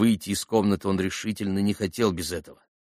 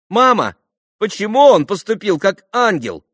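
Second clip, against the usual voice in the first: high, loud, insistent question; little girlish voice; 12 semitones higher, 8 dB louder than usual. A narrator's reading. Lise questions her mother through the door, high little voice. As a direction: pipe up demandingly from the next room. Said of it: "послышался опять голосок Лиз".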